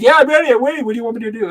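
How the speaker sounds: high, loud, puzzled Rodney impression; Rodney Dangerfield. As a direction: play the confused comic who is ready to go. Rodney voiced in retold story.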